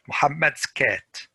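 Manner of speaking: usual speaking voice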